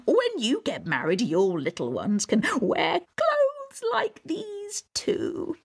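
swooping troll voice, drawn out and cooing; doting Mother Troll. Acted. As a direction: gush slowly as a besotted troll mother.

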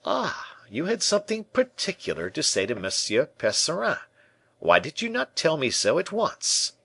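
high-pitched, exclaiming discovery; surprised realization. A narrator's reading. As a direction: exclaim it high, as if just catching on. D'Artagnan feigns sudden realisation.

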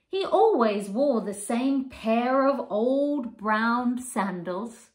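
lively storybook reading; reading to children. A narrator's reading. Teacher reading picture book to children, animated. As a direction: bring the pages alive for little ones.

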